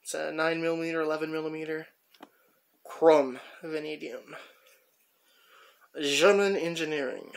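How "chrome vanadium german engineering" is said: badly faked German accent; horrible German accent. Tool collector playfully reading a German wrench's markings, bad German accent. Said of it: "That's a horrible accent".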